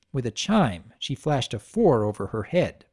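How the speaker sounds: plain reading voice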